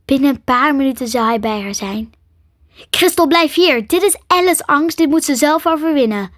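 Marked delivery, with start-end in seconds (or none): 2.9-6.3 urgent raised-pitch command; calling out. A narrator's reading. Nick holding Crystal back from helping Ellen, urgent call. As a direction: call firmly to stop a friend. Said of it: "riep Nick".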